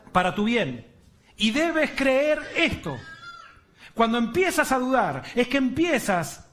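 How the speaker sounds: a build of fervent preaching over the list; rising preaching. Acted. Preacher to the congregation, building a fervent list. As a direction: press each trial home.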